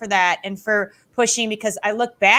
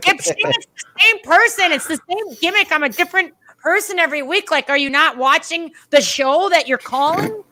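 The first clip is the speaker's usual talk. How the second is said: high, incredulous retold rant; hammed-up exasperation, pitched up; exasperated mock scolding; 7 semitones higher than usual. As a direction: spell out the obvious to someone clueless. Retold scolding of oblivious commentator.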